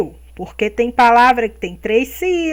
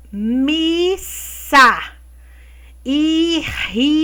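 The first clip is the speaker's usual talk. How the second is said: slow word reading; 3 semitones higher, much slower than usual. Shown as a demonstration. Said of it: "leia as palavras do quadro a seguir".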